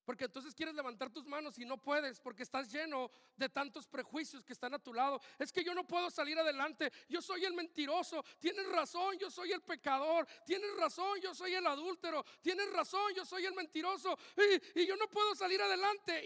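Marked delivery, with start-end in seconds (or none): 5.4-16.2 loud, high, building preacher cry; preaching at full force. Acted. Preacher crying out the sinner's confession, loud and high. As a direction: preach it out, rising to a shout.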